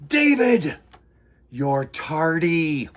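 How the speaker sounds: loud, scolding teacher voice; stern teacher. A narrator's reading. Teacher scolding David in the hall, loud and stern. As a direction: scold him like a strict teacher.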